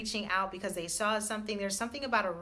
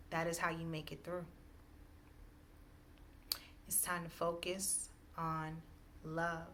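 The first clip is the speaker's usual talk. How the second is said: deliberate, spaced-out words of encouragement; spiritual message; 6 dB quieter than usual. Real, not acted. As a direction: let each short phrase land, unhurried. Delivers a slow spiritual message.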